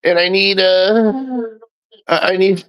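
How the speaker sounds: high, slow, whiny fake-sick plea; mock pleading beggar. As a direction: ham up a sob-story plea for donations. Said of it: "and be like".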